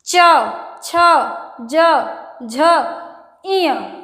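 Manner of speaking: च, छ, ज, झ said one by one with pauses; slow demo letters. Shown as a demonstration. Teacher sounding out the palatal row, slow and deliberate. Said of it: "ये जो ऊपर का हमारा भाग".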